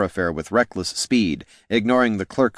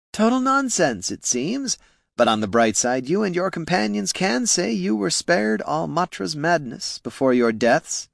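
higher, mocking villain voice; smug villain; 10 semitones higher than usual. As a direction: gloat over the dying man. Flynn speaks to dying Niln.

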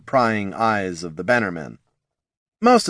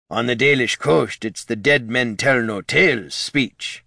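lightly voiced sea captain; a hint of a salty captain; pirate captain voice; 2 semitones higher than usual. Captain Jones chiming in, lightly voiced sea captain.

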